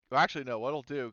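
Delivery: normal talking voice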